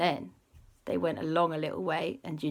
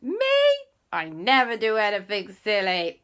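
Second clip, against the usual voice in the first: high, loud, innocent protest; squeaky mock-offended denial; indignant denial; 4 semitones higher, 8 dB louder, slower than usual. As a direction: insist you never do anything silly. Aunt denies being silly.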